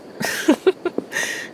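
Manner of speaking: airy unvoiced chuckle; breathy laugh. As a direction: let out a soft breathy laugh. Laughs at her own quip.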